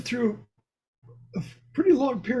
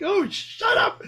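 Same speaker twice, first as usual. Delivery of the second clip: high, drawn-out mock yell through laughter; retold shout; 12 semitones higher, 3 dB louder than usual. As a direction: laughingly act out yelling at your own thoughts. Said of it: "it's like".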